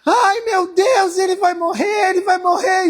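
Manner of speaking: high, loud mock yell of a frightened woman; hammed-up hospital panic; retold panicked scream. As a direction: imitate her screaming in panic. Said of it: "começou a berrar".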